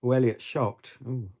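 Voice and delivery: ordinary talk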